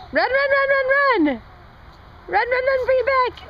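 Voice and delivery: high excited cheering; yelling from the sidelines. Real, not acted. Urging toddler to run.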